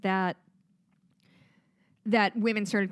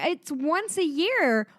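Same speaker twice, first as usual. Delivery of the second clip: ordinary talk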